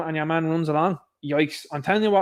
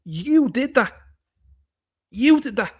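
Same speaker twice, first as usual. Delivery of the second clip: higher, emphatic finger-pointing; emphatic accusation; 7 semitones higher, 3 dB louder, slower than usual. Real, not acted. Accusing a political group of hypocrisy, emphatic accusation. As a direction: throw the blame straight at them.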